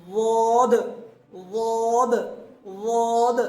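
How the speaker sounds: a heavy emphatic واد; emphatic daad. Shown as a demonstration. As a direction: push واد out from the side of the tongue. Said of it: "اس طریقے سے بولتے ہیں".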